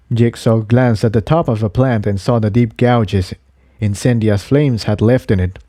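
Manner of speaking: ordinary delivery, nothing marked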